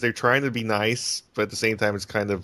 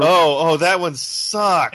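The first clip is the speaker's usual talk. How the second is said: drawn-out disgust; 6 semitones higher, 7 dB louder, much slower than usual. Real, not acted. Disgusted recall of bad episode.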